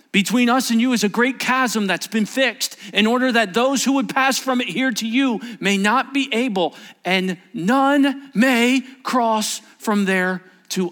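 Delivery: loud, high voice of Abraham, slowing at the end; impassioned preaching. Acted. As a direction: thunder it out to the congregation.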